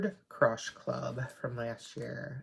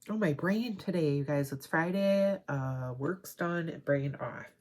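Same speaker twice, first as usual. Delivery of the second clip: ordinary talk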